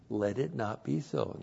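soft, understated reading; unemphatic. Shown as a demonstration. Teacher repeating the weak literal translation, unemphatic. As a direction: say it mildly, with no force. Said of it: "That doesn't sound very emphatic".

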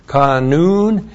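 kanun, said slowly as KAH-noon; spelled-out Hebrew word. Shown as a demonstration. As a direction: sound out kanun syllable by syllable. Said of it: "the adjective".

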